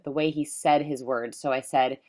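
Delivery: ordinary talk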